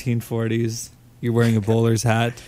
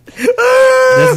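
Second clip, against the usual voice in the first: mock shout; 9 dB louder than usual. Mock ad twist, cry and shout.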